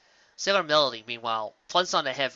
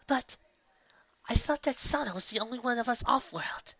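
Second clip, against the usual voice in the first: put-on young girl voice; 11 semitones higher than usual. A narrator's reading. Young girl's surprised question.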